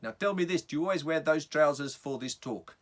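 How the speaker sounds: big South African guy calling out a question; South African accent. Acted. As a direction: challenge the speaker in a strong South African accent. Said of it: "I had a South African accent".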